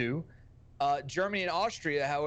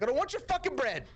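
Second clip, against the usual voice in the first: higher, fast, mock angry outburst; 10 semitones higher, faster than usual. Fed-up American tourist refusing the waiter's bread, mock angry outburst.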